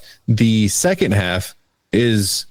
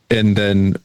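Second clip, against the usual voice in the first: ordinary talk, nothing marked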